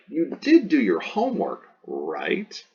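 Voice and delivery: parent pressing for a yes; high on the claim, dropping to a rising "right?"; mock parent coaxing agreement; leading parent. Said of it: "Parents do this one all the time".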